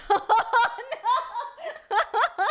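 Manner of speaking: high, loud laughing exclamation. Real, not acted. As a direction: squeal it through real laughter. Amused at what chat says.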